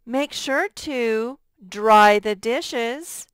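slow, clear reading; slow model sentence. Shown as a demonstration. Teacher reads the spelling sentence slowly to students. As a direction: read it slowly for the class. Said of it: "teacher models sentence".

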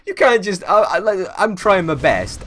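high, loud hammed-up protest; mock outrage. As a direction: play-act being deeply offended, shouting. Mock outrage at friend's dismissal.

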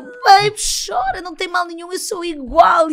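high, loud comforting shout, slowing at the end; shouting comfort to a crying friend; loud encouragement. Retold or imitated. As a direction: cheer her on loudly through tears.

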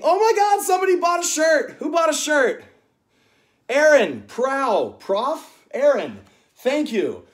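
thrilled high, loud shout; real excitement, high and loud; excited outburst. Real, not acted. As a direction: burst out in delighted surprise. Spots a shirt sale mid-stream.

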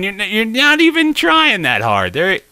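high, strained pleading; straining urging. Real, not acted. Player coaxing the bike up the obstacle, high and straining. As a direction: urge it on, pitch climbing way up.

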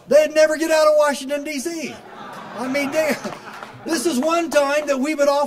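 cracking up at your own joke; laughing excitement. Real, not acted. Speaker joking to the crowd about Washington treason, high laughing.

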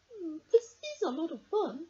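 put-on high, hopeful little girl voice. Emily's thought voiced.

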